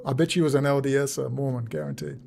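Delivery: ordinary delivery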